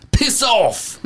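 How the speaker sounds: high, hammed-up British "Piss off"; mock British accent. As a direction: spit out "Piss off" like a rude Brit. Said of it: "in that stupid accent".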